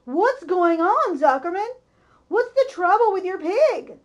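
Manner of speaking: announcer shouting to Zuckerman across the ring; alarmed loud call. A narrator's reading. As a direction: call out urgently over the loudspeaker. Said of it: "asked the loudspeaker".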